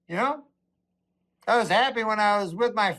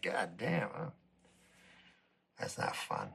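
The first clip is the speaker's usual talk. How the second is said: lower, much softer, trailing into nothing; deflated groan; 11 semitones lower, 15 dB quieter than usual. Retold or imitated. Comic waking up to his life, deflated and soft. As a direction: let the gloom of the day settle on you. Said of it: "You're like".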